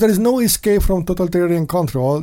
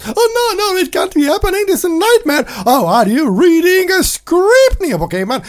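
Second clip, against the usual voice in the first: high, squealing mock-panicked voice; shrill fake panic; 13 semitones higher, 4 dB louder than usual. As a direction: ham up a terrified sheep. Mocking a panicked believer.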